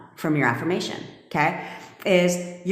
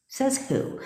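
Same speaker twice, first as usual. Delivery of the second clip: same question again, pitched much higher and pushier; indignant challenge; 10 semitones higher, 3 dB quieter than usual. Real, not acted. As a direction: fire it back high, daring anyone to answer. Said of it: "I hate those sayings".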